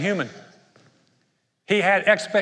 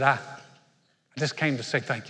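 humble thanks; 5 semitones lower, 6 dB quieter, slower than usual. Retold or imitated.

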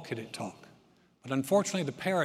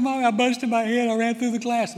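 put-on much higher, louder little kid wailing; crying child; 8 semitones higher, 10 dB louder than usual. Child runs to mom after glass door, high loud wail. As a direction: play the hurt child running to mom. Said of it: "I'd come running in".

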